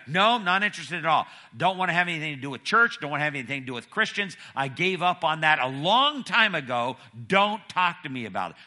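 loud, high, rapid-fire acted rebuff; vehement refusal. Grandma rejecting church and Christians to her granddaughter, vehement refusal. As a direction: refuse forcefully, piling up the objections.

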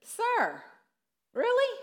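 can't believe he said that; incredulous. Retold or imitated. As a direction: pitch leaps up on really, disbelieving. Family reacts to the chaplain.

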